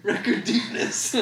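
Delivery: laughing while choking on spit; choking on saliva. Real, not acted. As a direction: sputter through the words. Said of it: "I was choking on my own saliva".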